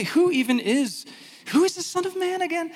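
high-pitched mock confusion; puzzled crowd. Crowd asks about the Son of Man, mock puzzled. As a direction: pitch climbs into a baffled question.

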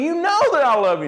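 exasperated insistence, pitched far up; frustrated. Retold or imitated. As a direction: plead in acted frustration, high-pitched. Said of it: "he was frustrated".

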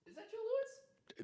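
very faint, away from the microphone; off-mic. Real, not acted. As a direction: ask it from off the mic. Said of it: "Your mic's off".

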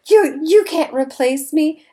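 high, loud mock protest; indignant waitress. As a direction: squeal it like an offended waitress. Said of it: "she's all like".

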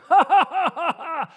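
loud, high scornful belly laugh; booming derisive guffaw; giant's mocking laugh. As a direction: laugh down at the scrawny boy. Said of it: "the giant looks at him and laughs".

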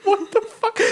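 incredulous laughing cry; laughing disbelief. Real, not acted.

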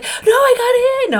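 high, loud, drawn-out excited cry; squealing with excitement; elated interruption. Retold or imitated. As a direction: burst in with a slow, high yell of triumph. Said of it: "I was so excited and elated".

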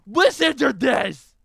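high, loud, drawn-out demand; excited yell. Retold or imitated. Host retelling playing it for Emily and Dad, urgent shout. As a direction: shout for everyone's attention. Retold calling family to listen.

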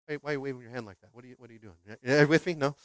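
ordinary talk

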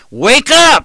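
high, loud shout of wake up; shouted warning. Retold or imitated. Preacher shouting at sleeping sinners, loud high shout. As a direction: yell it to wake a sleeping congregation. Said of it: "if you hear in the old Trailblazer".

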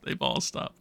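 soft whispered echo; whispering. Real, not acted. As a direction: whisper it to yourself. Repeats the line in a whisper.

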